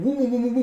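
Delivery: rapid, high, loud woom-woom noises; imitated whooshing blows. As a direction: voice a flurry of punches as sound effects.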